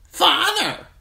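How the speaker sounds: very high, loud bird squawk; taunting old raven. A narrator's reading. Pet raven mocks the young man's hope, high taunting squawk. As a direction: mimic the word like a mocking raven. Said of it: "taunted the old raven".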